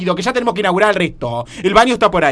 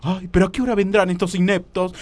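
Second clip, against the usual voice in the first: ordinary talk, nothing marked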